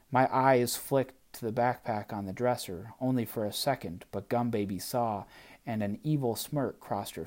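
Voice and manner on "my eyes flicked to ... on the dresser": ordinary delivery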